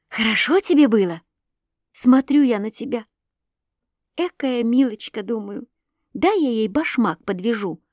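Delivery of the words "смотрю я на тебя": plain reading voice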